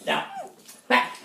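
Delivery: ordinary delivery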